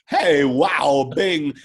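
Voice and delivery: lightly voiced Walken catchphrase; Christopher Walken. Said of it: "I loved how you were like".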